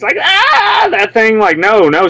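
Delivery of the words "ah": high, held mock scream; put-on bloody-murder "ah"; movie scream. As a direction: imitate a stock horror-film shriek. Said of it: "that scream that you hear all the time in the movies".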